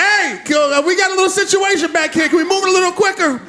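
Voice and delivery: shouting to the bouncers from the back of the line; yelling to bouncers. Retold or imitated. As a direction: call out to the bouncers over the crowd. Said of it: "I started yelling to the bouncers".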